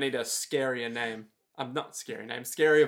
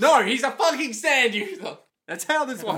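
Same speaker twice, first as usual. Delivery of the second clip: exasperated outburst; 8 semitones higher, 5 dB louder than usual. Real, not acted. Exasperated outburst at obvious villain.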